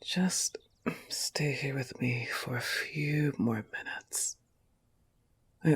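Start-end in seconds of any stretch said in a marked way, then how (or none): 0.0-4.4 hushed, slow morning whisper; soft whisper. Acted. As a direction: whisper to keep him in bed. Sleepy morning, keeping partner in bed.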